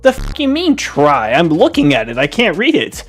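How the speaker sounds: high, loud, indignant outburst; outraged yell. Retold or imitated. Kid yelling at parents about the sign, high and loud. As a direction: shout back at the parents in disbelief.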